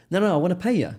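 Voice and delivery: high, loud Cockney imitation; retold Cockney bloke, insistent and high; Cockney Dave. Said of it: "I call him Cockney Dave".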